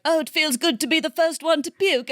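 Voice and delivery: a touch of a British actor's voice; British accent. Retold or imitated. Quoting Tom Holland in a light British accent. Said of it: "And then he's like".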